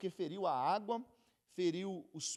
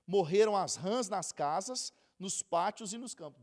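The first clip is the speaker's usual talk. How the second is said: louder, measured Bible reading; scripture reading; 7 dB louder than usual. Acted. Preacher reading Exodus to congregation, solemn and loud.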